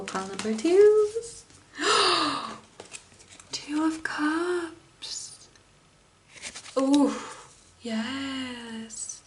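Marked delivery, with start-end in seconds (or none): 1.8-2.5 high, sudden gasp; delighted gasp. Real, not acted. Tarot reader seeing the Two of Cups, delighted gasp. Reacting to the card drawn. 3.5-9.2 slow, hushed and higher; delighted awe. Real, not acted. Tarot reader turning up a welcome card, delighted awe.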